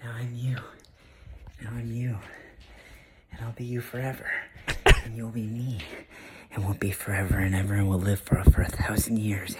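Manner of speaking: unsettling whisper into the camera; creepy whisper. Acted. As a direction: whisper it like a stalker in the dark. Creepy whispered video continues.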